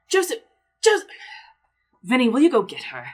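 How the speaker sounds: high, loud calling for his daughter. Acted. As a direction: call her name across the house. GM voices father calling out.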